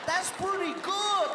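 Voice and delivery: Paul Rodriguez. Retold or imitated. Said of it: "He was like".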